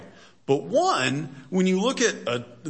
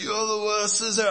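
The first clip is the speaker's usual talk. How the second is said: put-on high-pitched, failed British accent; harsh chef's put-down in a botched British accent; hammed-up, badly faked British accent; Gordon Ramsay; 10 semitones higher than usual. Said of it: "God judges like Gordon Ramsay on Hell's".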